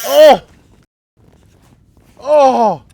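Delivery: high loud cries of dismay; anguished cries. Real, not acted. Line snaps on big fish.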